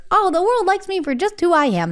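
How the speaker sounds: high, bright-eyed realisation; excited inner voice. Retold or imitated. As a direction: sing it out like a happy discovery. Retold inner thought.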